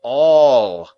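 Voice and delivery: one loud, weighty word; very emphatic. Acted. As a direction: land on All like a hammer. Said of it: "Joe repeated very emphatically".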